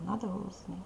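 ordinary talk, nothing marked

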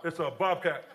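low, matter-of-fact fireman voice; acted official, low and understated. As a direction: drop low and deliver the verdict. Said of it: "then the fireman came out".

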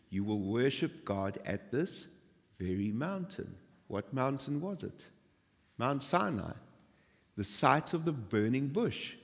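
ordinary talk, nothing marked

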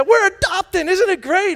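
high, loud excited shout. Retold or imitated. Clerk cheering the parents' adoption news, loud and excited. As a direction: burst out with adoption joy.

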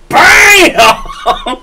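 high loud bam through a laugh; loud impact shout. Retold or imitated. Telling friends about the stair jump, laughing bam. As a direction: yell the slam like a sound effect.

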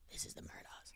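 hushed whisper of suspicion; paranoid whisper. Retold or imitated. Voicing their thoughts outside the family's room, paranoid whisper. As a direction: whisper it like a secret realisation.

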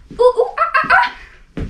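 monkey hoots. Acted. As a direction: whoop like jungle monkeys. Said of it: "came shouting".

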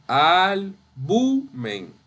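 AL-BU-MEN drawn out in separate syllables; teacher's slow breakdown of "Albumen". Shown as a demonstration. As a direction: sound out "Albumen" very slowly, bit by bit. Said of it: "Ven, no albumen, es albumen".

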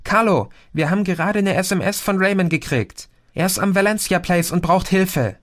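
high, rushed and breathless; urgent call for help, pitched high. A narrator's reading. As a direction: blurt it out fast, out of breath. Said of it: "rief sie atemlos".

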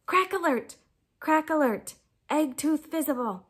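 lightly voiced hollow-minded owl calling out an alert; empty, moon-blinked voice. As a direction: announce it like a dazed, vacant owl. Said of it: "spoke in that empty, moon-blinked voice".

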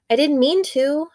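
put-on defensive boy. Boy defends himself to babysitter.

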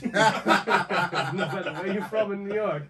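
slow, loud line broken by laughter; laughing drawn-out punchline. Retold or imitated. Telling the New York joke to friends, slow and laughing. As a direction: stretch the joke out while cracking up. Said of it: "we have this joke too".

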